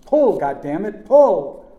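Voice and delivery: high, very loud desperate yell; screamed command. A narrator's reading. Uncle demanding nephew pull, screaming. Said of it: "Uncle Chick screamed".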